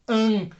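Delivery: "An" fully nasalized; nasal an. Shown as a demonstration. Teacher demonstrates the nasal vowel for students. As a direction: push the "An" up into the nose. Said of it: "Tem que sair aqui quase no nariz".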